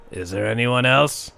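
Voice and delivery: a lightly voiced gruff stranger, far off; gruff distant voice. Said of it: "a gruff voice in the distance saying".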